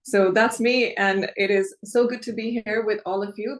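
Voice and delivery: ordinary talk, nothing marked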